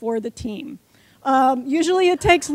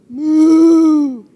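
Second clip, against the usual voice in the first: long, loud drawn-out moo again; cow moo; 11 dB louder than usual. Shown as a demonstration. Leading the audience in being cows, loud moo. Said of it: "Now we're gonna be cows".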